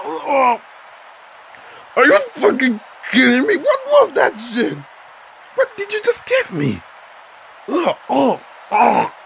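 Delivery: high, loud disgusted yelling; after bitter medicine. Acted. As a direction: shriek in disgust at the taste. Reacts to the awful-tasting dish.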